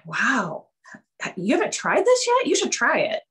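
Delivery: high, loud mock amazement; husband amazed the coffee sat untried, high and loud; excited husband. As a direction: play up the husband's surprise.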